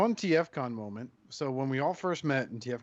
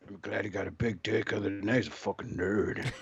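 low, hammed-up superhero wife; mock wife voice; 5 semitones lower than usual. Cohost plays the friend's wife insulting him, mock superhero voice. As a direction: put on a low, gruff-heroic voice for the wife. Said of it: "I'm Robert's wife".

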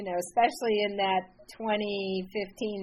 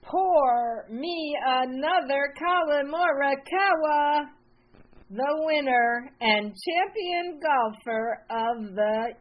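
slow, upbeat host intro read; podcast intro; 4 semitones higher, slower than usual. Acted. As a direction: announce the guest like a show host.

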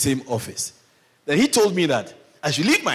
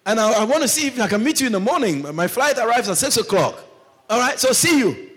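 chirpy pastor leaving a voicemail; hammed-up answering machine greeting; pastor's phone message; 8 semitones higher, 4 dB louder than usual.

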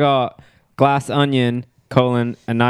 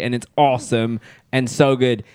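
ordinary talk

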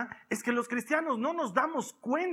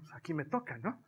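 mock private thought; 7 semitones lower, 9 dB quieter than usual. Voices his own private thought.

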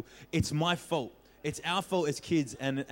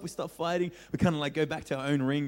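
usual speaking voice

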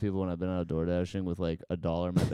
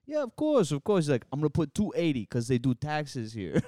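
higher-pitched eager clerk voice; lightly put-on obliging shopkeeper, pitched up; imitated bodega clerk; 5 semitones higher than usual. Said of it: "I got hooked up by my bodega dude".

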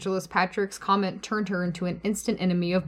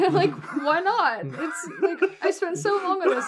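mock protest laughing; 9 semitones higher than usual. Voicing Millicent's protest, laughing.

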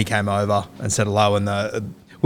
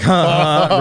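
loud, raised "ha ha ha"; mock laugh; 6 semitones higher, 5 dB louder, slower than usual. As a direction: imitate a laugh, louder and higher. Retelling his awkward laugh at caption.

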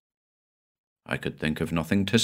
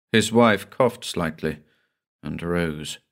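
usual speaking voice

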